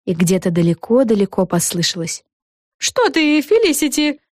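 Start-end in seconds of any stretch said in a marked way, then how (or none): 2.8-4.2 high character voice, ending drawn out; innocent denial. A narrator's reading. Café owner covering for the girls to Felicity, high innocent voice. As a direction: deny it in a raised, sing-out voice.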